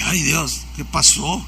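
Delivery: soft, low private aside; a hushed, lightly acted "oh dear" to yourself; understated dismay, dropped in volume; retold dismay.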